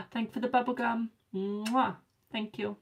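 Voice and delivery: high, gasping, delighted thank-you; gasp, then gush your thanks up high; thrilled thank-you for a little gift; excited thanks. Real, not acted.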